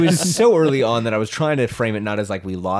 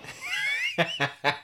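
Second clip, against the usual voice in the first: high squeaky laugh; 8 dB quieter than usual. Real, not acted. Laughing at memory.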